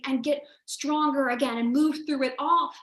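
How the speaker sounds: ordinary talk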